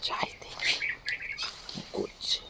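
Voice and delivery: hushed whisper to oneself; sneaky whisper. Real, not acted. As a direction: whisper while creeping up to check. Sneaking to check on child. (ফিসফিস করে)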